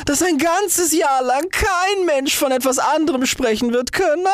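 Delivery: very high, sustained straining rant; frenzied boasting. A narrator's reading. Rocket promising a world-shaking bang, high frenzied cry. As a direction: boast at the top of the pitch, no letting up. Rocket raves before exploding.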